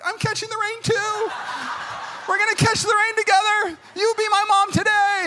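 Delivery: high, squealing excitement; mock childlike glee. Grown man joining girl and her mom, mock childlike glee. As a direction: squeal along like an overjoyed kid.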